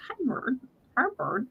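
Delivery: small, high, quiet pet-bird voice; tiny squeaky-soft bird greeting; Spanky. Retold or imitated. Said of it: "I swear he says".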